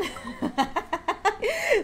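high, shrieking laugh; high laughing fit; burst of laughter. Real, not acted. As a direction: crack up at the chat. Streamer bursts out at chat message.

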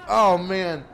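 normal talking voice

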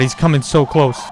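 ordinary delivery

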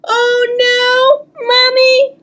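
high, whiny cry for mommy; upset wail. Acted. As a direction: wail like a scared little girl. Child wails for mother.

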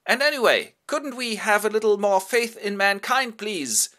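high, bright pleading character voice; pleading host voice. Acted. Biedermann begging his guests, pitched high and pleading. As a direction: plead for trust in a lifted voice.

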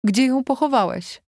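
high, urgent question; anxious demand. A narrator's reading. Wife asking husband about the burial, anxious.

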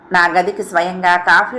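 usual speaking voice

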